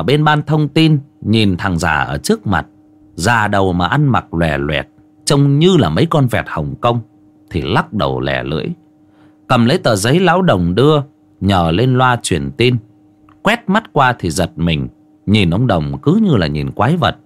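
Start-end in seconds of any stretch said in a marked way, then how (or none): none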